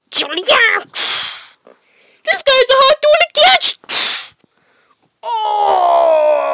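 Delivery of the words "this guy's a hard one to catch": excited. Real, not acted. Excited chase in game.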